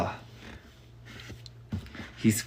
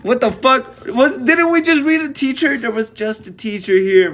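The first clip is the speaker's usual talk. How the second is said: much higher, louder baffled outburst; real confused outburst; 11 semitones higher, 4 dB louder than usual. Real, not acted. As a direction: blurt it out in disbelief. Reader confused by missing teacher.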